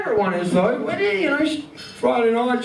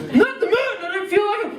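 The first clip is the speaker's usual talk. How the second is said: high, fast mocking whine; mock high girlfriend whine; mock whiny girlfriend; 11 semitones higher, faster than usual. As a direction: mimic her excuse in a squeaky voice.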